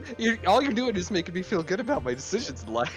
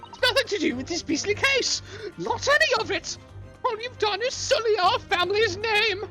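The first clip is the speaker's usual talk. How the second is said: higher prim offended lady; haughty lady voice. Acted. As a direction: play the scandalised lady. Plays haughty lady role.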